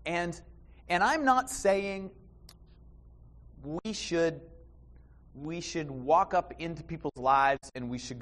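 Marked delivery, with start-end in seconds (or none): none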